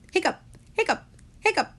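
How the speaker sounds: high squeaky hiccup sounds. A narrator's reading. Jonah hiccups at the table, high squeaky yelps. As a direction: hiccup each word up high.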